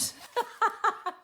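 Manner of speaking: sudden high cackle, real; loud burst of laughter. Real, not acted. Laughing at her own miscounted envelope. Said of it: "I don't mean to laugh out so loud".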